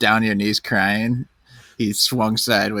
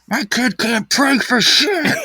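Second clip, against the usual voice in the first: high, drawn-out choking voice; mock strangled complaint; 13 semitones higher, 3 dB louder, slower than usual. Dad hanging by a rubber snake, complaining about his kid, mock-strangled. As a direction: squeeze out the words as if being hanged.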